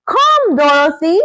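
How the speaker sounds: high urgent call; wizard crying out. Acted. Wizard urging Dorothy to the balloon, high urgent call. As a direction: call her over fast, urgently. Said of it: "cried the wizard".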